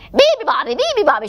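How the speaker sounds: high seal shriek, chanted. Acted. Seals keeping the bird awake, shrieking. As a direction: squeal it like excited seals. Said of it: "shrieked the seals".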